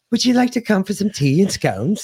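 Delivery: hamming up a polite British tea invite; mock British accent. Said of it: "I can't do a British accent anymore".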